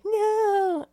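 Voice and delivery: high, drawn-out fake wail; mock crying. Girlfriend crying on the floor, high mock wail. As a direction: whine it like someone crying on the floor.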